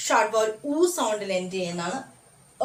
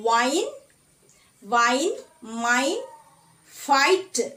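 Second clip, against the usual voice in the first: usual speaking voice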